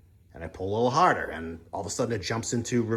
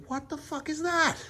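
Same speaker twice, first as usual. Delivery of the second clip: much higher, squeaky shocked exclamation; startled. Retold or imitated. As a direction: jump up high in disbelief. Brake light suddenly comes on.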